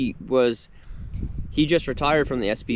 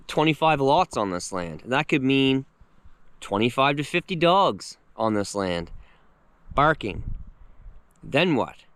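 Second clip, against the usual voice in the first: usual speaking voice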